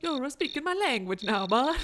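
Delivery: hammed-up cartoon Italian accent; attempted mobster slipping into Mario-style Italian; bad Italian accent. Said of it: "like, doing Mario".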